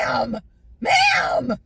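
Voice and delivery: loud imitated shout, strained; mock shouted ma'am. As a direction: echo the worker yelling ma'am at her. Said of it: "He was yelling at me like".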